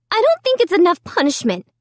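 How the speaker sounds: put-on high, complaining girl's voice; indignant sister. A narrator's reading.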